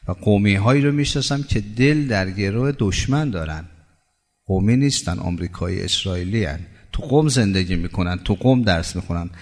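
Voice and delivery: ordinary talk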